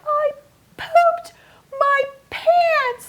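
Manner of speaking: put-on high, wailing child voice; upset little boy. Six-year-old confessing to his mom, high and upset.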